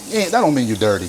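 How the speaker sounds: ordinary delivery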